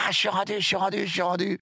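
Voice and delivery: high, strained cry of relief; exhausted cry of relief. Retold or imitated. A swimmer shouting he has made it, high and breathless. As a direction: gasp it out like a swimmer who has just touched the rocks. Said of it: "je fais".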